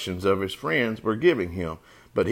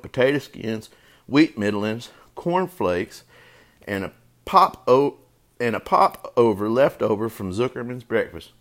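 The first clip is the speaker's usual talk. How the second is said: usual speaking voice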